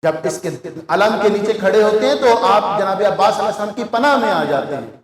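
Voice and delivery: ordinary talk, nothing marked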